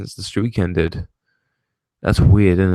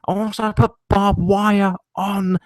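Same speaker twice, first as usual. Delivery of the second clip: much higher put-on promo voice; mock Paul Heyman announcing barbed wire; 12 semitones higher than usual. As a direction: ham up a scheming manager's pitch. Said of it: "Paul Heyman coming in".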